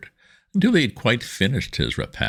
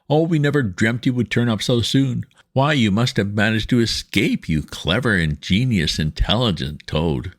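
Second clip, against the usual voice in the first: gushing praise; 6 semitones higher than usual. A narrator's reading. Mole gushing over returned Toad.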